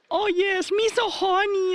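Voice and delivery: very high, loud, slow put-on voice; mock accent lady. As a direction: squeal it out like a caricature. Mocking lady's accent.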